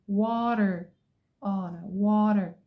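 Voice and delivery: ordinary talk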